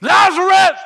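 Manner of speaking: high, loud call of a name; Jesus calling Lazarus from the tomb, a ringing shout; commanding shout. Acted. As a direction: bellow the name like raising the dead. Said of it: "Jesus interrupted their brunch".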